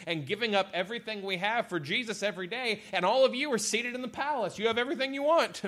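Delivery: high-pitched sarcastic complaint. Retold or imitated. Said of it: "he gets very sarcastic".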